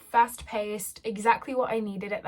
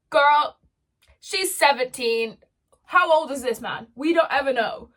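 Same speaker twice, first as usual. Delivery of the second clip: exasperated; 3 semitones higher, 7 dB louder than usual. Real, not acted. Ranting about age gap romance.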